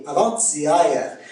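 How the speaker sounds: "Avant-hier" slowly, as avant-sya-ère; Québécois pronunciation. Shown as a demonstration. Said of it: "c'est A E".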